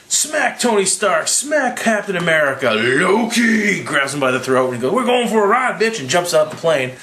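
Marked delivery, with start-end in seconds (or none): 0.1-3.8 high, slow hammed-up action calls; mock Thor storming in. Voicing Thor storming the plane. 4.9-6.0 high-pitched cocky one-liner; mock action hero. Voicing Thor seizing Loki.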